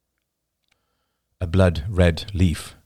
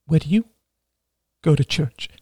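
put-on young woman voice; 9 semitones higher than usual. A narrator's reading. Rachel voiced higher.

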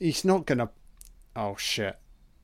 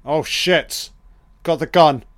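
sudden loud, higher shocked cry; real jolt of alarm, shouted; shocked outburst; 5 semitones higher, 10 dB louder than usual. Real, not acted. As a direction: blurt it out as the gun appears. Shocked reaction to gun on screen.

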